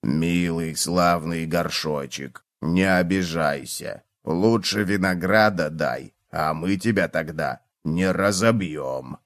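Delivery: soft, low and slow coaxing; kind voice. A narrator's reading. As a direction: purr it kindly, softly and slowly. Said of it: "добрым голосом прожурчал".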